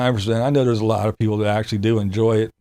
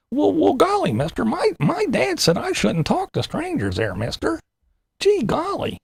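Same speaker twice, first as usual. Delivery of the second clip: put-on much higher, gee-whiz innocent boy voice; wholesome 1950s kid; 13 semitones higher than usual. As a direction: play a wide-eyed kid saying mister. Acting naive kid with stranger.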